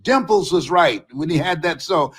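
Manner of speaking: usual speaking voice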